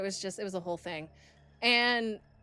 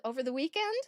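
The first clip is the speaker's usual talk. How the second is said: usual speaking voice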